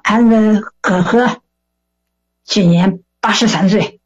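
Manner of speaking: put-on joking old matchmaker with Shandong accent; imitated Shandong elder; Shandong dialect. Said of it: "用山东话说".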